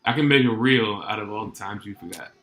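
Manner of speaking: usual speaking voice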